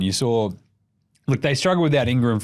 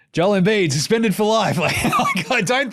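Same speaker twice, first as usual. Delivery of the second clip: laughing; 8 semitones higher than usual. Real, not acted. Mock headline, breaks into laughing.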